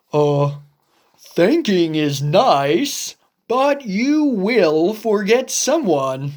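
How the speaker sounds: a patient, doubtful friend; Gerald the elephant. Acted. Gerald warning Piggy she will forget someone. Gerald voiced lower, slower.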